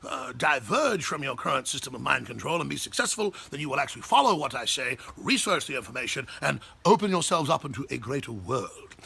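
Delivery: lightly put-on English accent, lecturing; a hint of a haughty English intellectual. English accent lecturing college critics. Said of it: "I'll do it with an English accent".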